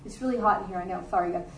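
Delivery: low, soft aside to the room; quick aside. Real, not acted. Preacher apologises to the congregation for the heat, quiet aside.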